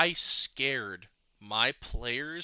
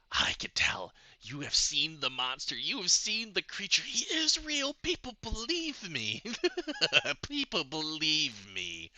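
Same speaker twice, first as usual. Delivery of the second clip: whispered start climbing high and unhinged; insane NPC; 4 semitones higher than usual. Acted. DM voicing a madman to his players, whisper climbing into high mania. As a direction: start hushed, then go high and manic.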